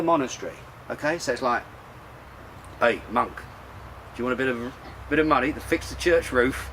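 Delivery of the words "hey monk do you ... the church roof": put-on a lightly done Cockney accent, barely moved from his own voice; a hint of Cockney, like a commercial brewer sweet-talking a monk. Commercial brewer offers a monk money, in a light Cockney accent. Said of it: "Was that your Cockney?".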